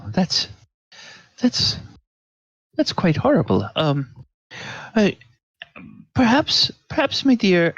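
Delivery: high, fussy gasp of a servant; shocked server. Acted. Server shocked that the lady nearly choked. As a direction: fluster over her like a fussy waiter.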